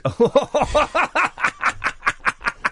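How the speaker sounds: long, high burst of laughter. Real, not acted. Host cracks up while introducing the show's producer, high laugh. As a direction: crack up helplessly mid-sentence.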